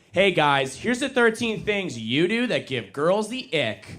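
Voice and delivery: high, slow, bright-eyed mock influencer. Comic imitates women's social media advice, high and sing-song. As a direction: lay out the ick list like a TikTok girl. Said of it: "beautiful women ... saying things like".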